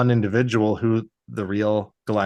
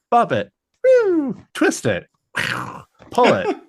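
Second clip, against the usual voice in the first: Bop It toy voice; 10 semitones higher than usual. Retold or imitated. Toiling prisoner playing Bop It, mock announcer. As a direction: call out like the toy.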